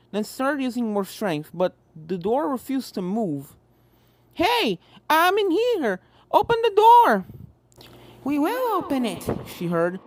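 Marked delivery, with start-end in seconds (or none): 4.3-7.2 high, loud, slow panicked call; trapped shout. A narrator's reading. Apple Bloom trapped in the closet shouting to be let out. 8.2-9.2 calm and sinister; eerie ghost twin. A narrator's reading. Ghost twin answering the trapped filly, eerie.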